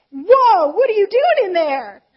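loud, high-pitched mock alarm; startled disbelief. Speaker questioning her own thankful spirit, startled disbelief. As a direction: call out incredulously, pitch jumping up.

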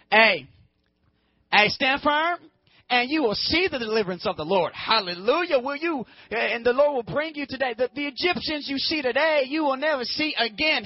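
high, emphatic mic-drop proclamation; triumphant declaration. Acted. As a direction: proclaim it like an unbeatable parting line. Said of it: "one of those comments where you say".

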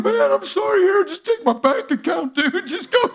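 high, loud, slowed pushover voice; high pitched gushing mock plea. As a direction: play the overly generous sucker, hammed up. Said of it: "You wanna be like".

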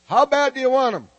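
loud, high preacher's shout; rousing challenge. Real, not acted. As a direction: fire up the congregation. Preacher challenges crowd to respond.

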